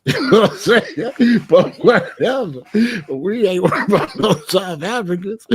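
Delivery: cracking himself up mid-sentence; giggling as he repeats the point; laughing. Real, not acted. Laughing through his own point.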